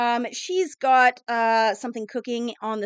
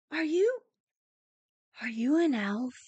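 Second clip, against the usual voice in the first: put-on timid little girl; 5 dB quieter than usual. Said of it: "and she's like".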